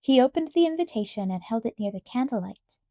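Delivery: usual speaking voice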